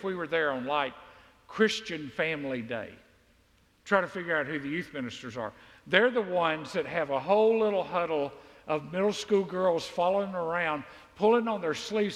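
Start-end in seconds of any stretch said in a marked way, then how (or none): none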